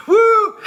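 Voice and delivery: loud, very high "woo"; imitated jeering cheer; mocking crowd whoop. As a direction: whoop like teasing guys. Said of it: "they're just laughing at Bob".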